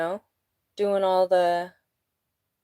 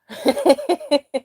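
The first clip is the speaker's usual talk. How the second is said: a quick burst of laughter, higher; short real laugh; 8 semitones higher, 7 dB louder than usual. Real, not acted. Laughing at her own quip that men stay silent. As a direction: laugh at your own joke.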